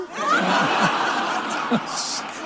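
long high breathless laugh. Real, not acted. Laughs at own joke. (langes, hohes, hauchiges Lachen)

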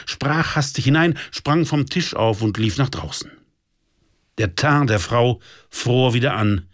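ordinary delivery

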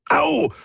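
high yelp of pain; sudden high "ow"; pained cry. A narrator's reading. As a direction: yelp as if burnt. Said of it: "diesmal allerdings vor Schmerz".

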